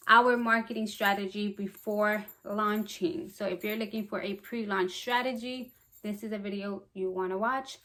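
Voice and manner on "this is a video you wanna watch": ordinary delivery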